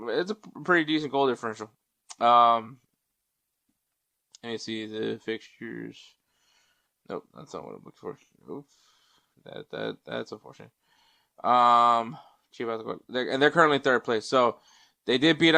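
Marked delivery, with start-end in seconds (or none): 4.4-10.7 quiet aside while searching; muttering to self. Real, not acted. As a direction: mumble it under your breath.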